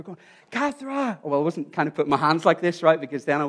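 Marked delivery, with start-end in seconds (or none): 0.5-1.2 high-pitched call without real volume; mock yell to someone far below; retold shout down. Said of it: "I was basically shouting down to her".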